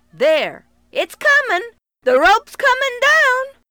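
loud high call down. Acted. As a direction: call down encouragingly. Cowboy calls down the well.

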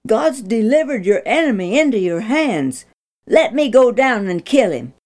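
put-on a young soldier who can hardly contain himself; quivering excitement. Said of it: "Abishai fairly quivered in excitement".

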